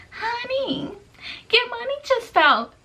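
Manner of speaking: high-pitched imitation of an old lady; mimicked old lady, sweetly high.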